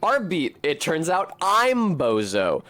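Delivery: normal talking voice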